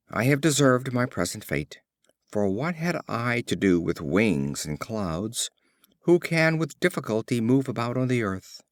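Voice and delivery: ordinary delivery, nothing marked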